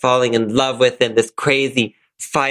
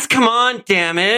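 high, hammed-up yell; heated family shouting; 10 semitones higher than usual. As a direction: yell it like a soap bad boy. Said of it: "Stop yelling at me".

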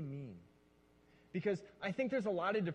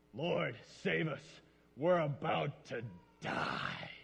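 mock solemn dread; 3 semitones lower, much slower than usual. Shown as a demonstration. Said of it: "I just picture".

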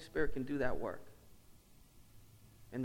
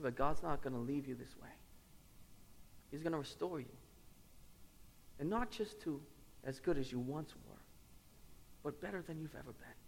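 soft, reassuring retelling of a gentle mentor; 2 semitones higher, 8 dB quieter than usual. Retold or imitated. Mentor comforting a crying pastor, gently. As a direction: quote him tenderly, in a hushed voice. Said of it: "in a gentle way, he said".

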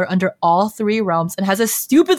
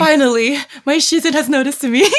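swooning Lo Binghe; 6 semitones higher, 3 dB louder than usual. Retold or imitated. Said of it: "Lo Binghe is like".

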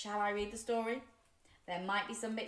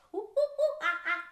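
high monkey oohs and aahs; monkey hooting; 10 semitones higher, 3 dB louder than usual. Pretend. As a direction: hoot like a playful monkey. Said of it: "in my monkey voice".

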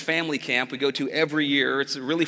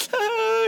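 high, sharp wail; child's cry of pain; 6 dB louder than usual. Retold or imitated. Son crying in dad's arms, high yelp. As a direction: let out a kid's hurt yelp. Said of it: "he's like".

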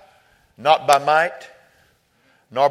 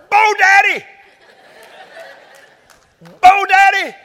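high, loud holler across a parking lot; high-pitched calling shout; imitated boy hollering; 8 dB louder than usual. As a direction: imitate a child yelling to someone far off. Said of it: "hollering across the parking lot".

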